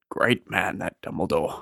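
low, gruff giant voice; Hagrid voice. Retold or imitated. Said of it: "I was doing Hagrid".

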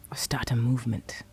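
low, near-whispered suggestion; hushed teasing. Acted. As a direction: drop to a soft murmur. Intimate teasing between lovers.

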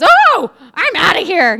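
high, loud, slow exclamation; bolting out the door; hammed-up panicky exit; fleeing woman. Woman fleeing prayer room.

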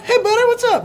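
chummy pushy street greeting; lightly voiced street hustler; homeless guy greeting.

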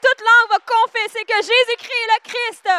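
high, shouted interpretation; match the preacher shout for shout; very loud, fervent pulpit cry; full-force preaching. Real, not acted.

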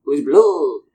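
grand, slow mock-French heroine announcing herself in a French accent. Podcaster doing the blue Jedi's cartoon voice for her kid, over-the-top French accent. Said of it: "I can do her voice".

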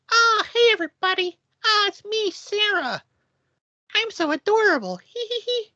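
very high squeaky cutesy voice; squeaky little voice. Retold or imitated. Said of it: "like, squeaky little voice".

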